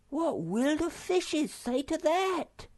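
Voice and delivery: high, slow worried cry; exclaiming Sniff. A narrator's reading. Small creature exclaims to friends, high and slow. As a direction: fret aloud about the fishes. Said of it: "exclaimed Sniff".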